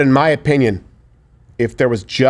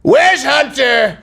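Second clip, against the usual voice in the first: put-on high, slow, confused old man; drawn-out doddering question; Biden impression; 11 semitones higher, much slower than usual. Mocking Biden asking for his son. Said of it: "Wait five minutes".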